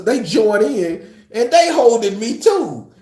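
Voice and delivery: ordinary delivery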